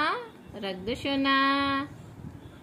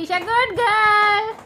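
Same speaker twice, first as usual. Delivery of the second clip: ऊँची, ज़ोरदार, धीमी शाबाशी; बेटी की जी भर के तारीफ़ करो; खुश होकर शाबाशी; 9 semitones higher, 9 dB louder, slower than usual. Real, not acted. बेटी ने बात मानी. (फाउंडेशन लौटाने पर बेटी को शाबाशी देती माँ, खुशी से)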